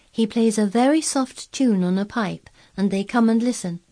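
lightly voiced admiring child; Mary. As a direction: explain it with keen, lifted voice.

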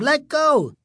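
high-pitched panicked cry, not full volume; scream. A narrator's reading. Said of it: "Greg screamed".

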